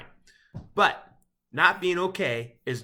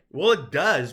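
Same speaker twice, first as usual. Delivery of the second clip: usual speaking voice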